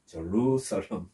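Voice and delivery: 제루살렘 slowly as "juh-ROO-sa-lam", long "roo"; English pronunciation. Shown as a demonstration. Preacher repeating the English pronunciation slowly, 제루살렘 as "juh-ROO-sa-lam". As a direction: model 제루살렘 English-style, drawing out ROO then falling. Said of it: "제루살렘 이렇습니다".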